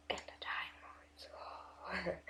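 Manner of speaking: sudden breathy whisper, trailing off. Real, not acted. Gamer listing needed items, whispering the last one. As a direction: drop to a whisper mid-list.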